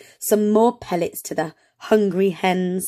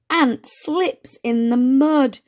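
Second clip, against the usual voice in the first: slow reading aloud; 5 semitones higher, slower than usual. Shown as a demonstration. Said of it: "Shall we say it together".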